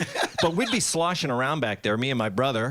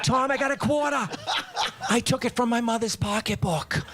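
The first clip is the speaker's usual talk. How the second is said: put-on first-grade kid; 6 semitones higher than usual. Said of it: "He said".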